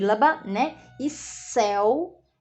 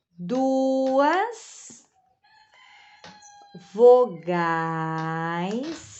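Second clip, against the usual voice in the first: very slow, drawn-out words with long pauses; dictating while writing; 3 semitones lower, much slower than usual. Shown as a demonstration. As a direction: stretch each word as you write it out. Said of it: "Então, vamos colocar". (ditando devagar enquanto escreve)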